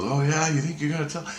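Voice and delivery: high-pitched, mild mock yell; retold angry yell. Angry man challenging the kid who'll tell, mock yell. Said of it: "he's yelling at me".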